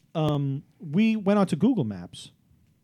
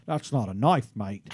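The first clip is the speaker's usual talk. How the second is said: attempted Australian accent, trailing down low on the last word; Crocodile Dundee; 6 semitones lower, 3 dB quieter than usual. Shown as a demonstration. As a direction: put on a movie Australian accent. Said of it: "you gotta do that Crocodile Dundee stuff".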